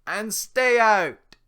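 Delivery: very loud, high slow roar of a laugh; Shrek's laugh and shout. Acted. Shrek chases off the villagers with a bellowing laugh. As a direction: laugh and yell them off. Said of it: "He laughs".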